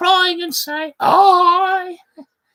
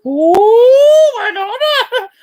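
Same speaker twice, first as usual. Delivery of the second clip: louder, higher burst of delight with a laugh; delighted nurse; 5 semitones higher, 8 dB louder, slower than usual. Acted. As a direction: crow with joy.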